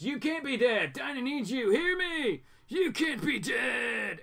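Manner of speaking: high, desperate cry over a dead friend; pleading shout. Acted. Green Arrow begging the dying Doctor, shouting high. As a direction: shout it, begging him to live. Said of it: "Green Arrow jumps in ... and says".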